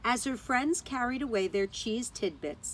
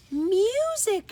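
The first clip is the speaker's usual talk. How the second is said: awed whisper of wonder; 11 semitones higher, 6 dB louder than usual. A narrator's reading. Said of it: "she thought".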